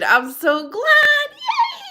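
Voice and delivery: excited cheer. Real, not acted. Cheering a viewer's recovery news.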